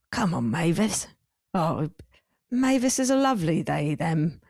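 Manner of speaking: put-on lightly done London accent; easygoing London accent, lightly imitated; Brett Goldstein accent. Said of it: "I can't do Brett's accent".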